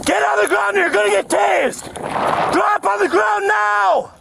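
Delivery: screaming orders at full force; shouted surrender demands. Real, not acted. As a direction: bellow it, high and slow. Police arrest, taser threatened.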